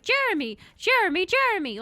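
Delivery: high, loud creature calling its own name; Pokemon-style name cry. Acted. Captured human who can only say his name, loud high creature cry. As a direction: chirp the name out loudly like a captured pal. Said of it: "default to being like".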